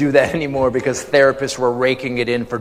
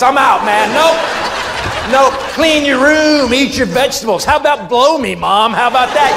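put-on higher, louder bratty kid yelling at mom; mock kid rant; 8 semitones higher, 4 dB louder than usual. Kid defying his mom, bratty yell. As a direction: shout it like a fed-up little kid. Kid voice rebelling against parents.

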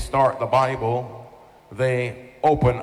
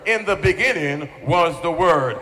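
raised, insistent sermon delivery; emphatic preaching; 5 semitones higher, 3 dB louder than usual. Real, not acted. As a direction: drive the repeated phrase home like a preacher. Preaches on John's opening.

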